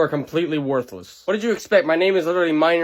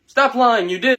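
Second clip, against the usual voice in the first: accusing; 7 semitones higher, 6 dB louder, slower than usual. Acted. Accusation in the bit.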